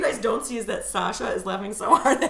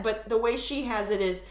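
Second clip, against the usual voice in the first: ordinary talk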